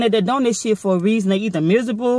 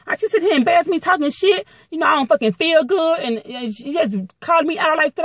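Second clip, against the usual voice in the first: higher-pitched, retold indignation; indignant protest; 7 semitones higher than usual. Protesting to the supervisor about being embarrassed, indignant protest. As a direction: retell your complaint, voice riding up.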